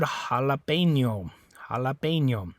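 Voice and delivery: Spanish jalapeño. Shown as a demonstration. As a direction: say "jalapeño" with an H sound. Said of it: "The first thing is the J".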